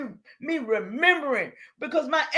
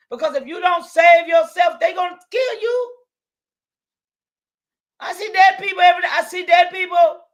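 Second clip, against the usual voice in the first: preaching shout; 5 semitones higher, 6 dB louder than usual. Real, not acted. Preaching warning to viewers.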